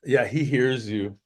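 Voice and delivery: ordinary delivery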